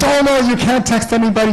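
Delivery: high, urgent "oh no"; alarmed warning. Retold or imitated. Husband stopping his wife from texting, high and alarmed. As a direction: stop her in a hurry, voice jumping up.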